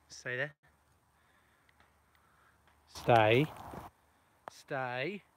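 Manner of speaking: sharp loud stay; firm dog command. Real, not acted.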